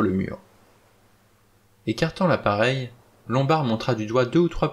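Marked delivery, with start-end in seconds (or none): none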